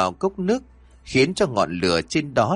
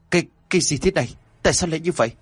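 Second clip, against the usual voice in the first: high, fast, stammering fright; full of fear; 7 semitones higher, much faster than usual. A narrator's reading. Trưởng làng seeing horrors in the cup, terrified. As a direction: blurt it out in rising panic. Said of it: "giọng điệu đầy sợ hãi".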